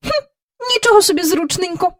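a sniffy, disdainful retort; зневажливо пирхнула; snorted. Acted. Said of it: "пирхнула Мері Поппінс".

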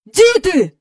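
high, loud, excited call. A narrator's reading. Mister Banks summoning the children, excited calling. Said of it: "гукнув він".

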